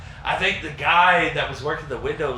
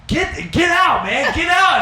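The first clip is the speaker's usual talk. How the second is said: much higher, loud shouted "get out"; angry manager; 12 semitones higher, 5 dB louder, slower than usual. Retold or imitated. Manager throwing the fake cook out, high angry shout. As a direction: bark him out of the kitchen, high and loud.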